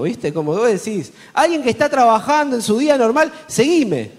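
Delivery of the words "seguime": a casual, off-hand "Seguime" tossed at a stranger; blunt street command. Retold or imitated. Preacher mocking a clumsy evangelist, blunt command. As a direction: bark it like a careless recruiter. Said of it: "la peor táctica de evangelismo".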